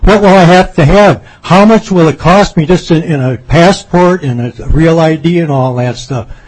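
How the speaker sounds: normal talking voice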